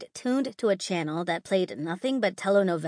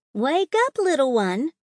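high lilting sing-song call; 7 semitones higher, 6 dB louder than usual. A narrator's reading. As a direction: sing it out sweetly, mockingly. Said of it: "a sing-song voice called".

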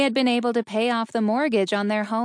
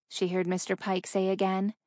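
ordinary delivery

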